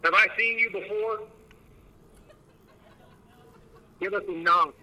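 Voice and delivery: high, coaxing question to a spirit; calling out to spirits. Real, not acted. Ghost hunter calling to spirits.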